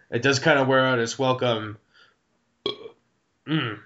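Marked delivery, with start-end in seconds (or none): 3.5-3.8 another burp breaking in; a muffled burp, then a sigh. Real, not acted.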